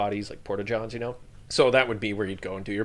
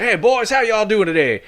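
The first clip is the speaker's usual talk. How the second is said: much higher, louder Texan greeting; Texan drawl; 11 semitones higher, 10 dB louder than usual. Retold or imitated. Texan handler greets the soldiers in a loud, high Texan accent. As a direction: hail the boys like a cheery Texan. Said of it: "Texan guy with a bomb dog".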